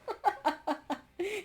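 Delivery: high bright laugh. Real, not acted. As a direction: burst out laughing. Laughing at nerd joke.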